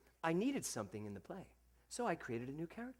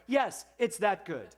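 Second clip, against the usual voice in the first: confident yes; 6 semitones higher, 9 dB louder, slower than usual. Retold or imitated. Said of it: "and I went".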